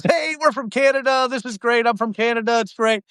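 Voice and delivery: high-pitched, overeager imitation; excited mock introduction. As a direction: imitate a friend gushing to strangers. Retelling Dean's keen introduction to stranger.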